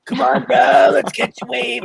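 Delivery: high, loud surfer dude; surfer turtle. Retold or imitated. Imitating the Finding Nemo turtle, a high loud surfer dude. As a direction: shout it like a stoked surfer turtle. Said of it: "the surfer from, um, Finding Nemo".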